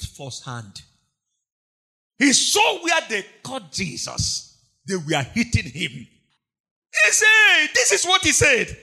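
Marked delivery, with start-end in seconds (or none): 5.9-8.8 shout. Acted. Preacher shouts at Peter's horror.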